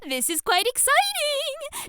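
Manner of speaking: high, slow, gushing delight; excited. Acted. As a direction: savor the thrill of it. Excited teacher.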